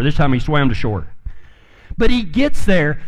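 ordinary talk

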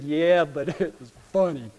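low, soft chuckling reply; laughing friend. Retold or imitated. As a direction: chuckle it out, unrepentant. Ralph laughing at him.

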